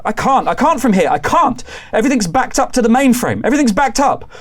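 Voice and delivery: high, loud, fast, frantic explaining; pleading that it's out of reach; desperate panic. Acted. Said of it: "she's like".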